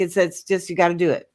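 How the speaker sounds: normal talking voice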